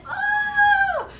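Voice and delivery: shrieked high and loud like a bitten mouse; a sudden playful shriek; mock yelp. Acting the bitten mouse for the children, yelping.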